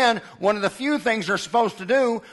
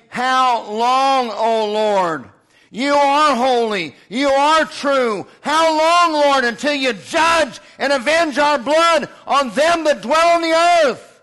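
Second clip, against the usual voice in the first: rising, louder and higher anguished cry; 4 semitones higher, 5 dB louder than usual. Acted. Preacher voicing martyred souls crying out to God, loud and pleading. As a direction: plead to heaven at full voice. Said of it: "what are they crying out?".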